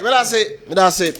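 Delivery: high and loud, insistent closing thanks. Real, not acted. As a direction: shout the thanks to wrap him up. Host cutting off the guest.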